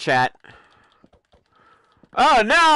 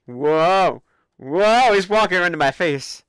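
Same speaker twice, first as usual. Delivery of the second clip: ordinary delivery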